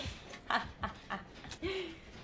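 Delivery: high laugh. Real, not acted. Laughing at chase.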